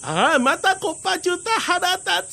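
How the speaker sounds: high, fast, fuming whine; huffy little tengu. Acted. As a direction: stamp your feet in a squeaky huff. 小天狗がまた怒る. (甲高く早口で怒る)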